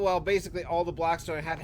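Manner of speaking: ordinary talk, nothing marked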